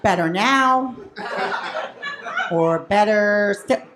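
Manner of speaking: slow, measured exam-room patter; eye doctor. Retold or imitated. Eye doctor boyfriend testing positions in bed, measured and clinical. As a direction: offer each choice like an optician testing lenses. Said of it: "he was an eye doctor".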